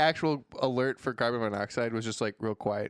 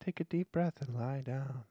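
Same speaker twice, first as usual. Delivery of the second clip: calm AI voice; 6 dB quieter than usual. Retold or imitated. Sinister AI voice again.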